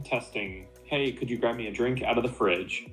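ordinary talk

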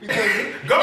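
normal talking voice

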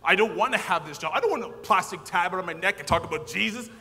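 mock-angry loud protest; retold protest. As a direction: argue it, laughing at yourself. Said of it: "I fought with this man".

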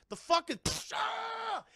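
high yelled monster cry of pain; pained shout. Retold or imitated. Monster screams as its eye explodes, shouting. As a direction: yell it out as the eye gets hit. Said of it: "He's like".

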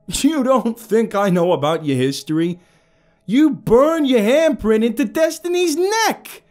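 screaming high and loud with rage; furious yell. A narrator's reading. Captive screaming defiance at the villain, furious yell. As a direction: yell it into his face, full fury. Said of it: "I yelled".

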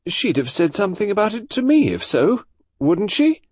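high-pitched indignant protest; indignant mother, pitch jumping up. A narrator's reading. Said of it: "still indignant".

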